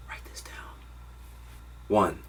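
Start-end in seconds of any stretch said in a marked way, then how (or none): 0.1-0.8 hushed stage whisper. Acted. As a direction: drop to a secret whisper. Dramatic aside to the viewer.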